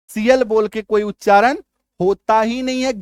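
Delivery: ordinary talk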